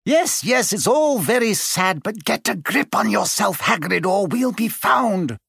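high, brisk schoolmistress urging him on; a lightly voiced stern teacher hurrying him; urgent whisper. A narrator's reading. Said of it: "Professor McGonagall whispered".

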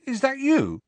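high-pitched, incredulous question; disbelieving. A narrator's reading. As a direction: ask it as if you can't believe your eyes. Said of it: "he said disbelievingly".

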